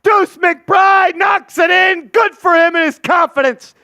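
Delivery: loud, high excited call; excited shout. Real, not acted. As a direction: yell it like a big shot just dropped. Made three, play-by-play call.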